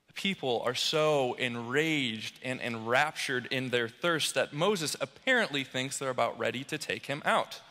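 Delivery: ordinary talk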